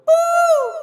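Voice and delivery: very high loud falsetto ooh. Retold or imitated.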